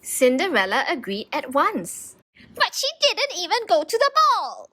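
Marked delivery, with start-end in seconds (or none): 2.5-4.7 high indignant shriek; a stepmother seething with outrage; furious shriek. A narrator's reading. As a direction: stepmother shrieks at the prince's choice. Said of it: "shrieked her furious stepmother".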